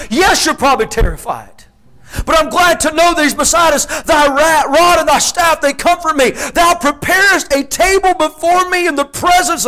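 high, loud, ringing preacher's cry; preaching at full force. Acted. Preacher to his congregation, shouting the psalm with fire. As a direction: lift the psalm to the rafters like a revival preacher.

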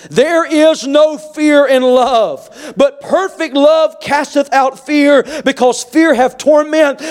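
high, slow, drawn-out verse; emphatic scripture preaching. Real, not acted.

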